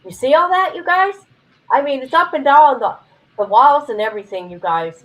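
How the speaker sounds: real loud, high outburst of amazement. Real, not acted. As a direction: call out loudly to the viewers in excitement. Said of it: "Sorry if I'm being loud".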